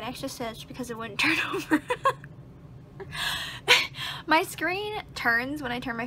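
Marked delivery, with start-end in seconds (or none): 1.9-4.2 high, airy burst of laughter; high breathless laugh. Real, not acted. Laughing at having insulted her phone, high and airless. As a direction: laugh helplessly at your own line.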